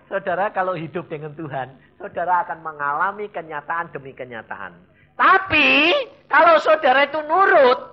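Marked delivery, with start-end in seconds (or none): 5.1-7.9 high, loud preaching cry; emphatic exhortation. Real, not acted. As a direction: drive it home to the congregation. Preacher exhorts congregation at full force. (seruan khotbah yang lantang)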